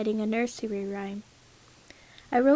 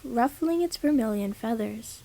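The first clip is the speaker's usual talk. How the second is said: ordinary delivery, nothing marked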